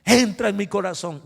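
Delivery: loud shouted prayer; shouting. Retold or imitated. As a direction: shout the prayer to match him. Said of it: "comienzo yo a gritar".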